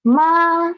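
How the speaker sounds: ma held high and level; first tone demo. Shown as a demonstration. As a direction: sing ma high and flat. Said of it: "then the four tone is".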